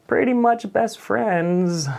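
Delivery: slow, high bragging voice; smug boast. Retold or imitated. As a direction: stretch it out, pleased with yourself. Boasting to friends.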